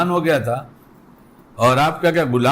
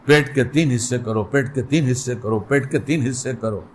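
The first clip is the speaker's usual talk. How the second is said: lightly voiced inner conscience, lower and fading; 5 semitones lower than usual. The conscience warning the eater, mildly low and fading. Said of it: "اندر سے آواز آتی ہے".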